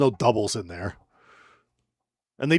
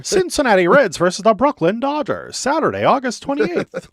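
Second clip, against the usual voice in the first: much higher, louder vintage radio announcer; old-timey announcer; 12 semitones higher, 5 dB louder than usual. Pretend. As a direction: read it like a 1930s newsreel. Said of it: "reading this like it's fucking old-timey baseball".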